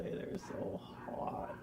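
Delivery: breathy confiding whisper, slow. Real, not acted. To her friend about the hot actors, whispered. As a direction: lean in and whisper the secret.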